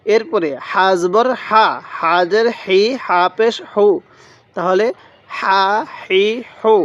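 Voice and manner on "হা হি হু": Arabic ha, lightly voiced. Shown as a demonstration. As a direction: say হা, হি, হু with a gentle sounded h. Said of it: "মুখ দিয়ে হালকা আওয়াজ করব".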